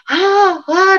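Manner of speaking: high, drawn-out mock screams; acting out yells of pent-up emotion; imitated frustrated screams. Preacher imitating David venting in his tent, mock screaming. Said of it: "dia teriak-teriak".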